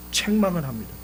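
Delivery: ordinary talk, nothing marked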